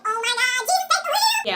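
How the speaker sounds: very high, loud mock shriek; mock horror. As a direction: squeal in exaggerated horror at a photo. Mock horrified reaction to pictures.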